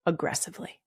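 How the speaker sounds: breathy whispered word; whispered aside. Real, not acted. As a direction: drop to a whisper for the one word. Adds a hushed correction word.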